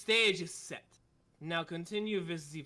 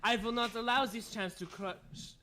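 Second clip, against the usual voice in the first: put-on German accent; 3 semitones higher than usual. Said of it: "It's so hard to do the accent".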